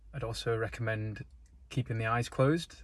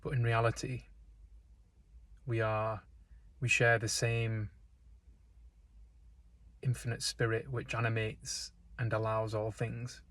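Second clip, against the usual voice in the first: calm, unhurried meditation voice with long pauses; guided meditation; 4 dB quieter than usual. Real, not acted. Guide leading listeners into breath and stillness, calm meditation delivery. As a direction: guide them gently, leaving space between phrases.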